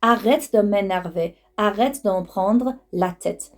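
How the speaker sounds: ordinary talk, nothing marked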